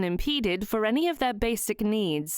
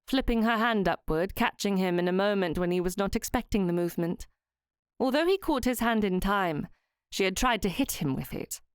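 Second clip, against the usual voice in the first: usual speaking voice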